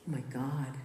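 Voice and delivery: plain reading voice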